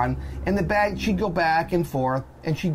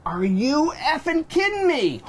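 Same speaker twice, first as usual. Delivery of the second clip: slow, higher, disbelieving exasperation; retold exasperated outburst; 10 semitones higher, slower than usual. Father losing patience with slow TSA agent, exasperated. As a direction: let the disbelief boil over, drawn out. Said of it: "Now I start losing it".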